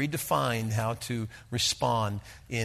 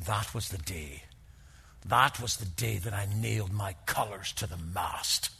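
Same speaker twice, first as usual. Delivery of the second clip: put-on lower, gentle Irish lilt for an old soldier; Irish accent; 5 semitones lower than usual. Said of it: "with his beautiful Irish accent".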